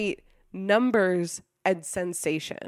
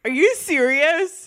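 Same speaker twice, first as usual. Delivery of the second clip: surprised; 6 semitones higher, 7 dB louder, slower than usual. Real, not acted. Surprised question.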